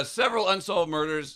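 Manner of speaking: scratchy rough voice; rough throat breaking through; voice cracking. Real, not acted. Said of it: "God damn, my voice".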